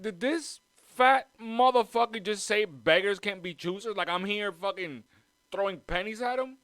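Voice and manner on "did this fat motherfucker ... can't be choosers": high, loud outraged disbelief; indignant outburst. Retold or imitated. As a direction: retell the insult with mock fury. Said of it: "I was like".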